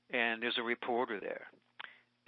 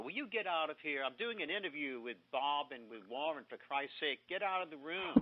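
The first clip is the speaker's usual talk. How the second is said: imposter shooing someone; 2 semitones higher, 6 dB quieter than usual. Retold or imitated. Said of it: "one of my imposters said that".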